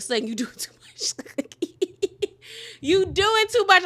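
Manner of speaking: laughing, exasperated teasing; laughing scold. Real, not acted. Laughing at Sutton for throwing her crown, teasing scold. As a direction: crack up while scolding her. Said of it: "that's where I was like".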